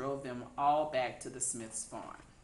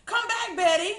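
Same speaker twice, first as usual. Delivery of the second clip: high, loud yell after the pig; 11 dB louder than usual. A narrator's reading. Children yelling after a runaway pig. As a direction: call the runaway back at full voice. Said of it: "they yelled".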